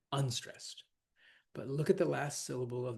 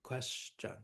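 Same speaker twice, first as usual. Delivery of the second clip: "Question" with a weak, softer second syllable; unstressed final syllable; 2 semitones lower, 8 dB quieter than usual. Shown as a demonstration. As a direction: break "Question" into QUES-tion, letting the end drop. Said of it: "add an extra unstressed syllable".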